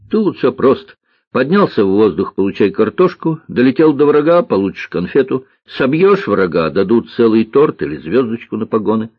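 lightly voiced boy, brisk list intonation; маленький лётчик. Маленький лётчик объясняет порядок наград. (мальчик-лётчик бойко перечисляет)

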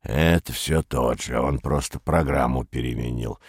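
put-on very low elderly voice; low, knowing old man's verdict. A narrator's reading. Shrewd old man dismisses Perets to the others, low and aged. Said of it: "сказал проницательный старик".

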